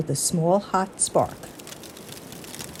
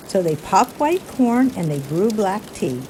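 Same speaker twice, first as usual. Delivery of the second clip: plain reading voice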